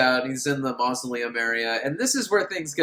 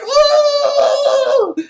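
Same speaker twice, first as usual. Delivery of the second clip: much higher, louder yelp; mock pained cry; 11 dB louder than usual. Man hit by the sphere, high pained cry. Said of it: "He's like".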